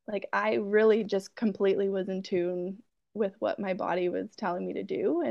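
ordinary talk, nothing marked

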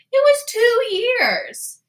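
high, loud, slow burst of disbelief; emphatic exclamation. Real, not acted. Telling listeners the cave story, sudden loud amazed exclamation. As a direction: shout out the shocking number.

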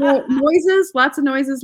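laughing through the word. Real, not acted. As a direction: breaks into a laugh. Laughing while listing tips.

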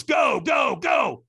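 trainer yelling go. Retold or imitated. Trainer sending him across the coals, shouted urging. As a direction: drive him onto the coals. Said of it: "And he goes".

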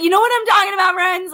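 loud pointed quote. Retold or imitated. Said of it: "I said".

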